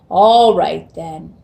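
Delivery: loud, slow troll giving in; grudging troll. Acted. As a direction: let the troll grumble yes. Troll agrees again.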